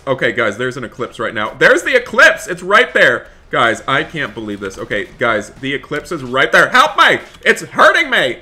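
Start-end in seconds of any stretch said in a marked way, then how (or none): 1.5-3.3 high, loud, thrilled call; excited shout. Acted. Pointing out the eclipse to viewers, loud and thrilled. 6.7-8.4 high, loud hammed-up cry for help; mock panicked scream. Crying out as the eclipse hurts her, mock panic. Eclipse hurting character in game.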